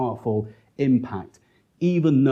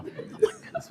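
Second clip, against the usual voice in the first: exasperated gasp; 9 dB quieter than usual. Retold or imitated. Exasperated reaction to heckler.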